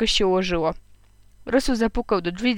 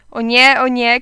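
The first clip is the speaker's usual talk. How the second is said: raised-voice outcry of protest; crying out; 3 semitones higher, 7 dB louder, slower than usual. A narrator's reading. Headmaster cutting short two crying boys, crying out. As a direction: exclaim it louder, like a stern headmaster. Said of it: "krzyknął dyrektor".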